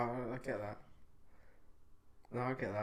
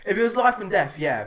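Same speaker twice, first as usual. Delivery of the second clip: laughing; 8 semitones higher, 10 dB louder, faster than usual. Real, not acted. Cracking up over killing chicken.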